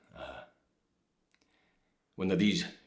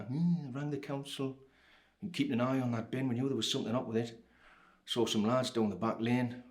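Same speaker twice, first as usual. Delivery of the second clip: higher-pitched voice of a watchful neighbour; nosy neighbour woman; 4 semitones higher, 3 dB quieter than usual. Retold or imitated. Woman in a dressing gown telling what she saw, higher gossipy neighbour voice. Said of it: "I talked to a woman in a dressing gown".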